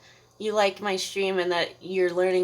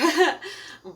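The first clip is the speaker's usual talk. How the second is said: laugh; 10 semitones higher, 5 dB louder than usual. Real, not acted. Laughs at the story.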